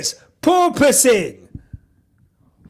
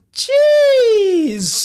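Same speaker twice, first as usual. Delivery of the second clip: excited outburst; 7 semitones higher than usual. Real, not acted. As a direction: shout it out at the lap time. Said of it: "reacting to lap time".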